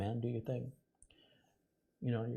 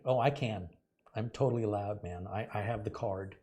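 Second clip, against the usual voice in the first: normal talking voice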